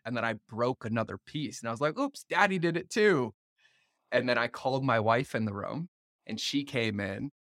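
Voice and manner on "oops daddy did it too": higher, playful "oops, daddy too" teasing; playful mock oops. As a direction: make light of the breakage for the boy. Playfully owning up to son.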